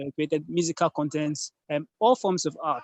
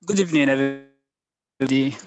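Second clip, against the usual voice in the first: louder, projected greeting; speaking up; 5 dB louder than usual. Real, not acted. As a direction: raise your voice to be heard. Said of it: "Can you speak louder".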